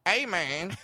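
lightly voiced bad impression, drawn upward at the end; Dave Chappelle. Famous comedian staring at a fan, terrible impression. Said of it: "do a terrible Dave Chappelle impression".

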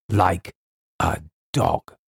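grim word-by-word confirmation; deliberate repeat. A narrator's reading. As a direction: separate the words, dropping on "dog". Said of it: "said Colonel Carteret".

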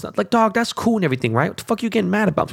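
high-pitched, incredulous and fast at the end; exasperated rant. Real, not acted. Host venting at Philly fans, high exasperated rant. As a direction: push up into disbelief at the critics. Ranting about Philly cheesesteak critics.

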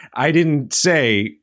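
slow, deliberate stress on say; pointed, measured I didn't say; emphatic denial. Real, not acted. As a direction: spell it out word by word so nobody misquotes you. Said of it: "I wanna be clear".

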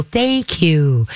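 calm, stretched-out thank you; soothing, unexcited praise. Shown as a demonstration. Owner rewards a sitting pup with a tickle, calm. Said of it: "that keeps the brain calm".

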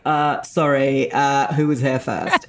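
put-on snooty British accent retort, drawn-out "sorry"; mock British voice, affronted; British classmates. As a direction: imitate British students pulling rank. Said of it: "They're like".

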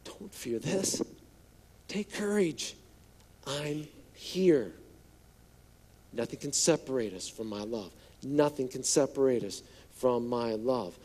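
soft, low, gentle reassurance; tender Jesus. Acted. Jesus answering fearful disciples, soft and tender. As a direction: comfort them quietly. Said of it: "answers that question for them".

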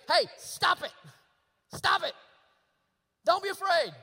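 loud, high, sharp command; stern yelled order. Acted. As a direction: yell it as an impatient scolding. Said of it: "two different ways that you can tell them".

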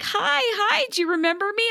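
high chirpy mock greeting; imitating Jen. Imitating Jen greeting the mom, high chirpy. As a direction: chirp it up high, overly friendly. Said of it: "Jen's like".

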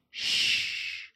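hushed shh; whispering a secret. Pretend. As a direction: shush like sharing a secret. Mock secret hushing.

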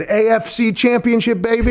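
stretched-out, high-pitched mock victory call; Gronk. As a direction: imitate a hyped player celebrating in the locker room. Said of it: "And Gronk actually said".

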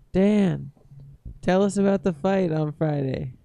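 ordinary talk, nothing marked

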